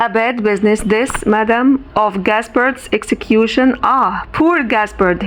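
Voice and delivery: usual speaking voice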